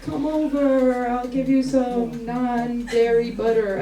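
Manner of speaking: slow, drawn-out vegan come-on; mock seduction. Comedian imitating Brooklyn vegans offering non-dairy butter, mock-seductive. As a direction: lure them over slowly, mockingly.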